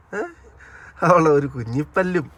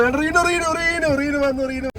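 much higher, eager calls of the name; excited calling. Real, not acted. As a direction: greet her arrival with excitement. Excitedly calling wife arriving. (ആവേശത്തോടെ റീനുവിനെ വിളിക്കുന്നു)

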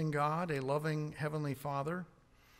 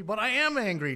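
loud, higher protest; defiant anger; 6 semitones higher, 6 dB louder than usual. Retold or imitated. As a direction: snap back at God, defiant. Arguing back with God.

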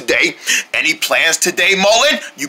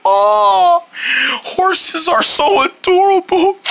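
crying; 10 semitones higher, slower than usual. Acted. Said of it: "are you crying?".

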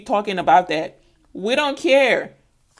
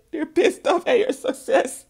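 high voice squeezed by laughter; real laughing fit; 10 semitones higher than usual. Real, not acted. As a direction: talk on while helpless with laughter. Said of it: "I'm laughing so much".

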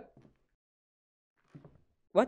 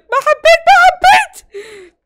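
very high, loud wail of protest; shrieked protest in fright; panicked scream; 13 semitones higher, 14 dB louder, much slower than usual. Real, not acted. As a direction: scream your refusal, stretching it out. Rejects a scare in game.

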